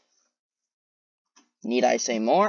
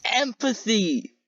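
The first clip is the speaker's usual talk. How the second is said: mock gushing; 10 semitones higher, slower than usual. Mocking her cried claim.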